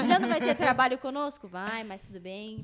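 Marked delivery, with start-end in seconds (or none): 0.0-1.5 much louder, higher through laughter; laughing. Real, not acted. Host teasing editor Juliano, laughing loudly. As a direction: tease the editor while laughing.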